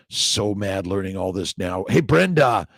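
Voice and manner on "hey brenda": loud greeting, pitched up; calling out. Real, not acted. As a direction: call out to Brenda. Calling out to viewer.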